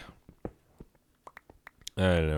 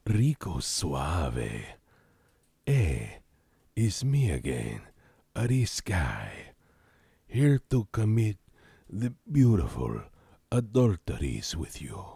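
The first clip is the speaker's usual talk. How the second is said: Latin lover voice. Pretend. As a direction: purr it like a telenovela charmer. Seductive Latin lover character bit.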